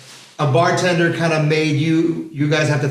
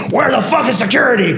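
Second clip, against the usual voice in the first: shouted demand; 5 semitones higher than usual. Retold or imitated. A voice behind him in the club yelling for security, a mild mock shout. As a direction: holler it across the club.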